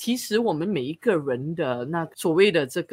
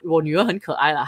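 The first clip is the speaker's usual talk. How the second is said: normal talking voice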